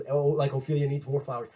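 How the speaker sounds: normal talking voice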